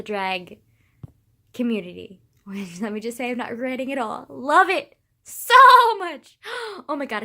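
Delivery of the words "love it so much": goat-like bleat. Real, not acted. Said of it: "I sounded like a fucking goat".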